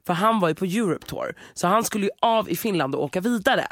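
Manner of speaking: ordinary talk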